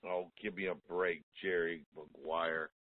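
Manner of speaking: normal talking voice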